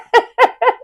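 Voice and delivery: high-pitched amused cackle; helpless high giggle; high laugh. Real, not acted. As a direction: burst out in a squealing laugh. Laughs at the funny episode.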